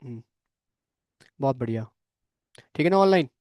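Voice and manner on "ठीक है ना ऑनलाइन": a higher call, a bit louder. Real, not acted. Teacher checking on students joining online, calling out.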